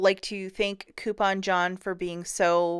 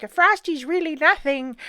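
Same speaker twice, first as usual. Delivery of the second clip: higher, dismissive mock voice; mock scoffing viewer, pitched up; 8 semitones higher, 4 dB louder than usual. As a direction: brush it off like a doubter. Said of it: "'cause you're like".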